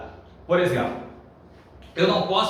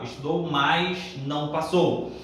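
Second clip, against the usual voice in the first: slow demo sentence; slower than usual. Shown as a demonstration. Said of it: "aí é uma conjunção".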